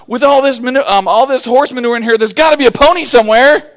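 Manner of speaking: high, loud, thrilled; bursting with delight; excited kid. Retold or imitated. Optimist kid in the manure, loudly thrilled. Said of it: "jumping out and throwing it".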